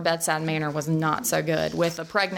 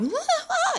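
very high mock sobs; hammed-up burst of tears; imitated wailing. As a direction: let out two squealed cry noises. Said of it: "your reaction is real".

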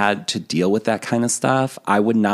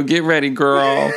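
teasing warning; 5 semitones higher than usual. Pretend. Teasing warning, repeat of earlier.

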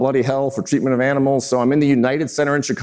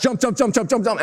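rapid-fire "jump" count, pitched up; really fast; 7 semitones higher than usual. Retold or imitated. Clown speeding up the jump count, really fast. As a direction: rattle off each "jump" with no gaps, higher. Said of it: "it goes really fast".

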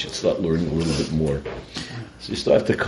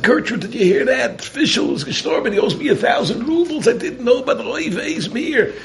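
much higher, louder panicked call; alarmed calling out; 5 dB louder than usual. Acted. Host calling his wife about a dead debtor, high panicked cry.

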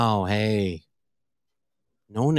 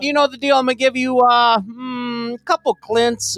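game host voice; 8 semitones higher than usual. Acted. Host announcing the game segment.

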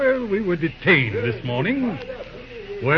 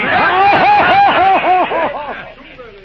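a loud, high, amused laugh; hearty laugh; 13 semitones higher, 9 dB louder than usual. Acted. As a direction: laugh it off loudly. Judge laughs off Graham's insult.

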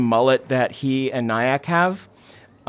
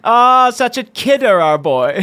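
higher, teasing mom voice; indulgent mother; 6 semitones higher than usual. Acted. Mother laughs off her son's talk of a parasite, teasing and indulgent. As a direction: humour the odd boy. Lexana humours son.

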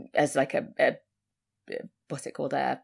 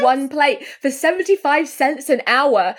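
high gasping mock outrage; a lightly voiced shocked mum, gasping; mock outraged mum; 9 semitones higher than usual. Retelling the film mum's shock, lightly voiced high outrage. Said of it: "the mum starts screaming 'cause she's like".

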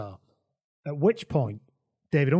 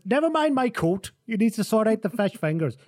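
much higher, insistent demand; indignant retort; 9 semitones higher, 3 dB louder than usual. Retold or imitated. Humphries snaps back at Johan, indignant and high. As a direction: brush him off, pitched right up.

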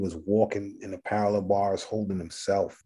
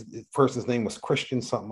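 normal talking voice

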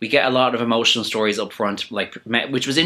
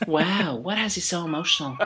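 higher, syrupy probing host; 8 semitones higher, 5 dB quieter than usual. Retold or imitated. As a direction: fake sympathy to get tears on camera. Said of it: "Ru was like".

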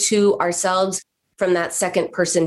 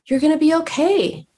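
higher, tender and comforting; kind reassuring tone; 10 semitones higher than usual. Shown as a demonstration. Therapist showing a patient kind self-talk, tender. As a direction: soothe her like a dear friend. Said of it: "say the same words in a different tone".

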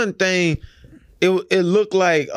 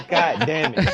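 laughing; 5 semitones higher than usual. Real, not acted. Laughing at friend's misunderstanding.

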